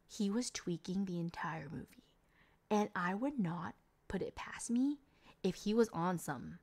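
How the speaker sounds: conspiratorial whisper; whispered aside. Real, not acted. Reviewer gossiping about the skater kid in a whisper. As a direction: whisper it like a secret to the viewers.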